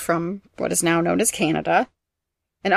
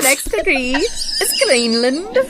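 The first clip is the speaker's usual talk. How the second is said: high, slow, hammed-up announcement; mock quiz host; 7 semitones higher, 3 dB louder, much slower than usual. Host reveals the answer to losing guest, mock announcer.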